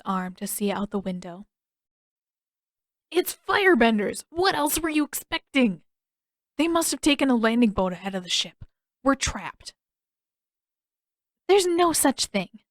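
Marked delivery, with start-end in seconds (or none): 3.1-9.7 alarmed. A narrator's reading. Panicked news. 11.5-12.6 defiant. A narrator's reading.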